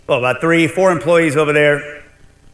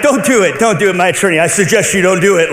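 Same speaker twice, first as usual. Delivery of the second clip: louder, higher mock pleading; insistent retold objection, pitched up and loud; urgent warning; 4 semitones higher, 5 dB louder than usual. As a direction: imitate an alarmed lawyer urging restraint. Attorney retold warning him off.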